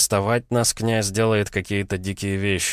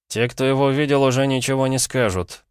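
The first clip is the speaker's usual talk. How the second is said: high-pitched sullen grumble; grumbling; 7 semitones higher than usual. A narrator's reading. Son answers his father's warning, grumbling. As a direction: grumble back in a raised pitch. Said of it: "пробурчал Полозов-младший".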